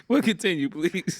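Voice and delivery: high, breaking up with laughter; laughing. Real, not acted. Host trying to continue the interview, laughing. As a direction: try to carry on through real laughter.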